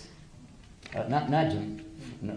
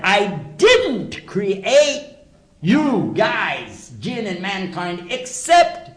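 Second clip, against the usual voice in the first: slow, loud and high, each word drawn out; emphatic divine quote; 8 semitones higher, 9 dB louder, much slower than usual. Acted. Preacher quoting God's words to the audience, slow, loud and emphatic. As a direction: deliver it slowly and weightily, as God's own words. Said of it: "emphatic when you say it in the negative".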